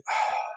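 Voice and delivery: long exasperated exhale; weary sigh. Retold or imitated. Retelling his reaction to a prank caller, weary sigh. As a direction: sigh as if fed up.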